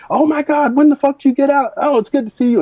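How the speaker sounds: excited greeting. Retold or imitated. Buddy greets Sam at the door, overjoyed high yelling. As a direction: whoop it up like a long-lost buddy.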